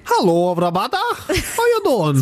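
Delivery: mock Scottish accent, slow and rising high; hammed-up bad Scottish impression; jokey Scottish voice for his co-host.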